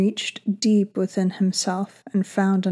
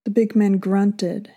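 ordinary delivery, nothing marked

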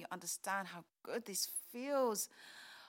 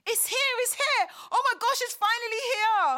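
high, loud squeals of joy; breathless high excited cry; excited shriek; 11 semitones higher, 13 dB louder than usual. Acted. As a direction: scream it out, the letter has finally come. Transcript arrives in the post.

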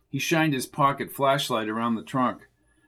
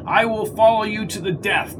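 higher, eager fawning voice; fawning pledge; 8 semitones higher, 3 dB louder than usual. A narrator's reading. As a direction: grovel with fake loyalty. Mundy pledging loyalty.

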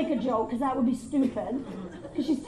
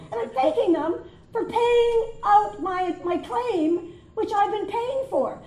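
incredulous outrage; 5 semitones higher, 4 dB louder, slower than usual. Acted. Comic outrage at thanking insurer.

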